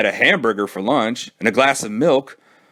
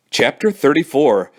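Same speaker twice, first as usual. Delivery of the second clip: ordinary delivery, nothing marked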